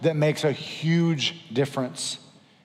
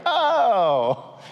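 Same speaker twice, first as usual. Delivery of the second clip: high, loud mock 'oh' sliding down; hammed-up moment of revelation; exaggerated, falling cry of discovery; sudden realization; 9 semitones higher, 4 dB louder than usual. Acted reply to God's yelling.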